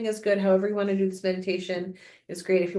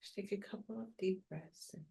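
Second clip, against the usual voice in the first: calm grounding exercise; 6 dB quieter than usual. Acted. Leads a meditation.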